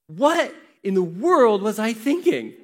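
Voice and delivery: loud, high, drawn-out self-reproach; slow incredulous question, leaning on each stressed word; exasperated disbelief. Retold or imitated. Preacher looking back on a teenage idea, exasperated disbelief.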